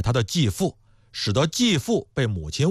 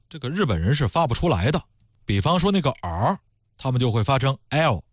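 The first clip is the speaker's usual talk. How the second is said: normal talking voice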